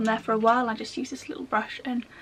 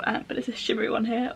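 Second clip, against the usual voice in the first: usual speaking voice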